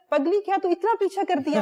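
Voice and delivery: laughing teasing quote. Retold or imitated. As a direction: retell his fond teasing through laughter. Retold teasing of [name]. (ہنستے ہوئے پیار بھرا طنز، اونچی آواز میں)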